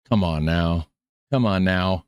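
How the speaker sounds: ordinary talk, nothing marked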